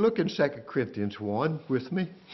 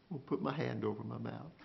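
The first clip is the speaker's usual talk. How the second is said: softer and quicker, falling away; hushed; 2 semitones lower, 12 dB quieter, faster than usual. Acted. Job falling silent before God, hushed and quicker. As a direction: say it as if already silenced.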